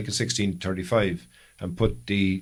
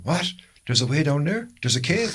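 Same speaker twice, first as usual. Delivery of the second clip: excited; 6 semitones higher than usual. Retold or imitated. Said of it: "We'd be going".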